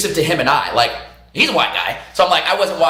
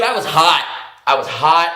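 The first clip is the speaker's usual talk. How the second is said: normal talking voice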